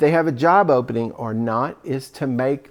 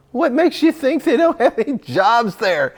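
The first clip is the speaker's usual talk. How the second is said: pitched way up in disbelief, questioning; incredulous challenge; 8 semitones higher than usual. Real, not acted. Coach scoffing at a job seeker's excuse, incredulous and high. Rebutting an objection.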